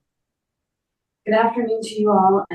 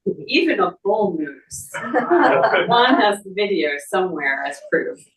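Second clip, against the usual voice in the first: a real chuckle spilling into the words; amused, slowed reading with laughter; laughing while reading; 3 semitones higher, 3 dB louder than usual. Real, not acted. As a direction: laugh through the anecdote, slower. Funny moose anecdote while reading.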